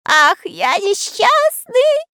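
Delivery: very high, slow loud wail; mock sobbing. A narrator's reading. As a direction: wail over his fate. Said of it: "рыдал Мурзилка". (рыдает тонким голосом)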